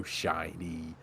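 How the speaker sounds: soft, hushed little marvel; mock awe. Admiring new headphones, soft mock awe. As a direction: coo softly over a new treasure.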